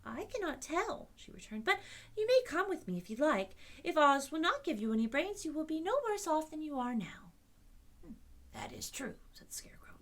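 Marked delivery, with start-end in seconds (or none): 0.0-7.3 put-on higher, louder girl's voice; little girl. A narrator's reading. 8.0-9.1 husky scarecrow. A narrator's reading. Scarecrow whispers.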